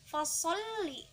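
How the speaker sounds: "fasholli" with the double l pressed and held; lam ditekan. Shown as a demonstration. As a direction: lean on the ll in "fasholli". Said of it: "lam bertasydid harus ditekan". ("fasholli" dengan lam ditekan dan ditahan)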